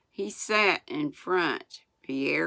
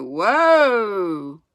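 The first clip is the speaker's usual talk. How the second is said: loud, higher, drawn-out holler; yelled halt; 4 semitones higher, 9 dB louder than usual. A narrator's reading. Boy under flying hooves, long loud yell. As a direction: yell desperately to stop the team. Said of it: "yelled Almanzo".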